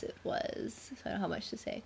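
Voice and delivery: sheepish whisper. Real, not acted. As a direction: whisper the admission, embarrassed. Sheepish reply about a short chunk.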